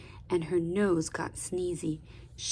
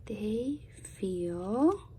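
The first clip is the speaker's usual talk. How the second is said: whispered prompt; 7 dB quieter than usual. Shown as a demonstration. Reader prompts listeners.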